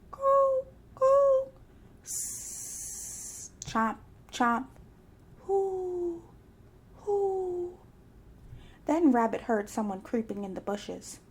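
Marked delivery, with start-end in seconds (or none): 0.1-1.5 high cooing bird call. A narrator's reading. Narrator imitating a bird in the dark woods, high coo. 2.0-3.5 hiss. A narrator's reading. Scary woods sounds. 5.4-7.8 owl hoot. A narrator's reading. Scary woods sounds.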